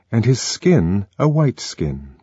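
ordinary talk, nothing marked

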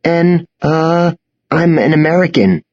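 halting, frightened stammer; boy at knifepoint stammering out an answer. A narrator's reading. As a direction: trip over the words, caught off guard. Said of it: "I stammered".

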